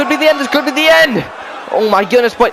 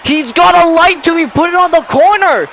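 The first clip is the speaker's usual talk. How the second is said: excited shout; 9 semitones higher than usual. Real, not acted. Repeats louder to partner.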